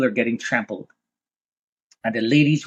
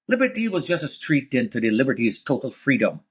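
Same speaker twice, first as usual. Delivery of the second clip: ordinary talk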